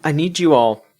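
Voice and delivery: ordinary talk, nothing marked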